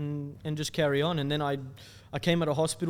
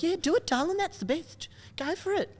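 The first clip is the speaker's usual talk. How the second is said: much higher put-on mum voice; imitating his mum Anna. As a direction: do mum cheering him on, high and sweet. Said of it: "That's how you sound, Anna".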